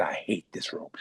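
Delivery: grumbled under the breath, hoping nobody hears; muttered under breath. Retold or imitated. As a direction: mutter it to yourself, almost swallowed. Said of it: "I said".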